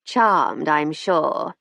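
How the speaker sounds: unpleasant nasal drawl. A narrator's reading. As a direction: say it through the nose. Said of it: "in an unpleasant nasal voice".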